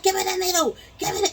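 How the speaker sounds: high, loud, demanding voice; impatient doctor. Retold or imitated. African doctor grabbing the needle, high and demanding.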